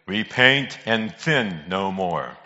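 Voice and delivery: slow, drawn-out booming pronouncement; great voice from heaven. Acted. Great voice answering the praying painter, slow and solemn. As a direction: proclaim it slowly as God's great voice. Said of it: "a great voice come out".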